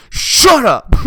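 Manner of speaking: high, loud burst; sudden high-pitched yell; yelled retort. Acted. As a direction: shout it down in mock outrage. Yelled retort to imagined killer.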